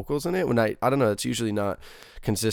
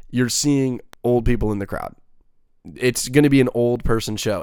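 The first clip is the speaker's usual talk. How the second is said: ordinary talk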